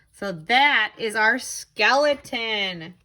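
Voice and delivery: ordinary talk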